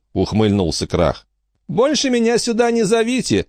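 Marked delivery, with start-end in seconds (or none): 1.7-3.5 high-pitched dismissive Angelica line; tossed-off parting remark. A narrator's reading. Angelica refusing as she heads for the exit, tossed off high. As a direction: throw it out as a parting shot. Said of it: "бросила Анжелика".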